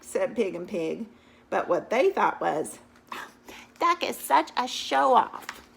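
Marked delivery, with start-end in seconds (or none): 3.8-5.6 louder, slow, put-on sneering voice; scornful pigs. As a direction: sneer it slowly like jealous pigs. Pigs mock duck.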